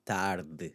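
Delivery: "Tarde" with its e reduced, then the bare "d"; swallowed ending on d. Shown as a demonstration. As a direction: finish "Tarde" on the d, vowel barely there. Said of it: "see what it does to the D".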